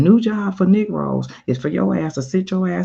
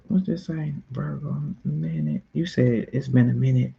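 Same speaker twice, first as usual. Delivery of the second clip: usual speaking voice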